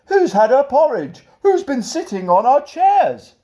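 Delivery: high, loud outraged bear voices; indignant bears crying out. Acted. Three bears find their porridge eaten, high indignant cry. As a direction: squeal it like offended bears. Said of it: "who cried".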